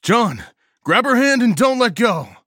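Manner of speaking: high, very loud yell; desperate full-voiced order to a friend; urgent shouted command. A narrator's reading. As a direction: shout over a howling blizzard. Shouted order while fleeing into blizzard.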